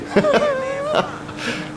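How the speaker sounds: a shrill cracking laugh at the game; high laughing burst. Real, not acted. Laughing at Franklin's failed jump, a high squealing laugh.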